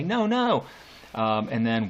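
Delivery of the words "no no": put-on high, light little-kid voice; child's protest. Lecturer mimics children answering, child's high protest. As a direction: pipe it up like a small child refusing. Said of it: "and the children say".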